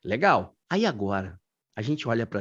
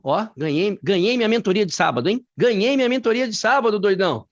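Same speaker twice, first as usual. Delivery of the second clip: loud, high-pitched celebration; excited shout, building on the repeat; jubilant; 9 semitones higher, 4 dB louder than usual. Real, not acted. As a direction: crow it like you just won a prize. Celebrates a student's correct answer.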